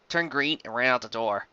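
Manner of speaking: usual speaking voice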